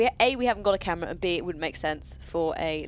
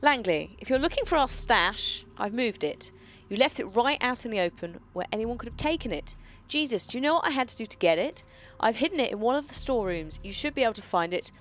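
louder read-aloud voice; reading a note; 2 semitones higher, 3 dB louder than usual. Acted. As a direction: read it like a found letter. Reads game note aloud.

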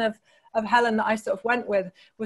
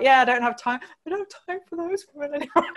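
playfully dismissive, laugh breaking in, pitch rising; laughing mock brush-off; 7 semitones higher, faster than usual. Author jokingly pretending she's done with the prize women, laughing. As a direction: toss it off as a joke, giggling by the end. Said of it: "and I'll be like".

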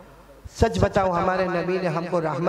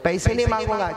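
usual speaking voice

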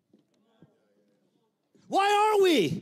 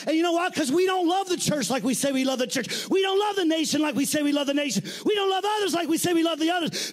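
full-force preaching; 6 semitones higher than usual. Acted. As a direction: build it up to the end.

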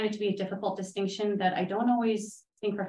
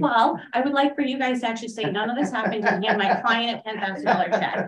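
loud, higher-pitched playful pitch; joking mock demand; 6 semitones higher, 7 dB louder than usual. Consultant jokes to commissioners about paying the client, playfully loud. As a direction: make a tongue-in-cheek request over the laughter.

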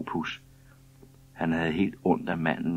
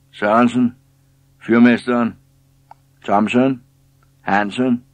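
loud, raised-pitch shouts, one name at a time; calling out names; 6 semitones higher, 10 dB louder, slower than usual. A narrator's reading. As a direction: call each name out across a busy deck. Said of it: "råbte han mærkelige ord".

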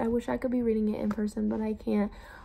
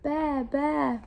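higher, drawn-out storybook baa; sheep's bleat; 4 semitones higher than usual. A narrator's reading. Reading the sheep to kindergartners, higher bleating. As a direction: bleat like a picture-book sheep. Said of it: "bleated the sheep".

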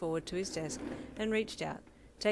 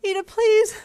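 high, loud tearful begging; desperate wail; sobbing plea; 16 dB louder than usual. Acted. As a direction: beg through sobs. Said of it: "you sobbed".

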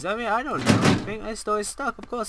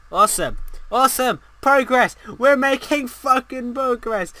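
loud, higher-pitched celebration; triumphant cheering; 5 semitones higher, 5 dB louder than usual. Real, not acted. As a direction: punch each word in triumph. Cheers progress in game.

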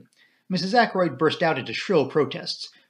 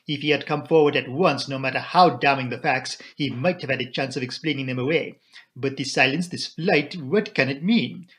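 grave, urging foreign detective; Poirot. Acted. Poirot warns Flora of her fiancé's danger, urgent and accented. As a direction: press the case with accented emphasis.

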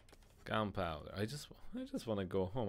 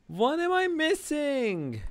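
high, drawn-out frustrated yell; exasperated gamer's high-pitched holler; frustrated shout; 8 dB louder, slower than usual. Real, not acted. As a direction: wail it out loud, stretching the words. Stuck player yells in frustration.

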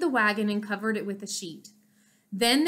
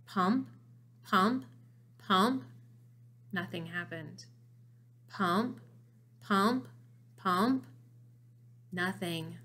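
soft, slow pump-pump beats; tense hushed counting; 6 dB quieter, slower than usual. A narrator's reading. Narrator building suspense at the pump, hushed rhythm.

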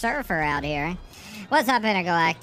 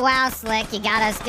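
ordinary talk, nothing marked

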